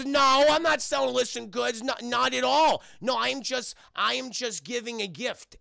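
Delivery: protesting innocence. Retold or imitated. Penguin caught selling assures the cop, protesting innocence. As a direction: deny it too hard, then ease off.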